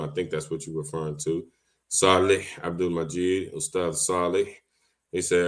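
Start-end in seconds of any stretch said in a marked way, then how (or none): none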